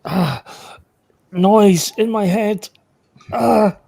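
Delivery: high, loud acted distress; pained outcry. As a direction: cry out as if your head is invaded. Player acts out mental intrusion.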